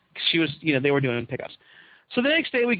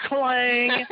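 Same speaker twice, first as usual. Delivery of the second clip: a punchy spoken clang sound effect, higher; mock clang; 7 semitones higher than usual. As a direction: say it like a bell hitting. Mock sound of awkward moment.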